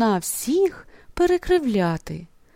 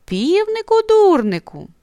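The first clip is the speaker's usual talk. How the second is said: teasing; 10 semitones higher, 4 dB louder than usual. A narrator's reading. Cloud teases the rooster.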